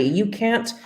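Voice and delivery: usual speaking voice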